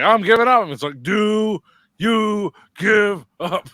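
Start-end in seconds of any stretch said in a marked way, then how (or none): 0.0-0.6 high, loud imitation of giving up; mock surrender yell. Co-host imitating Cole quitting the match, mock surrender yell. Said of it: "And Cole's like". 1.0-3.7 loud, high, very drawn-out taunt; mock demanding shout. Co-host acting out the demand to give up, mock shout.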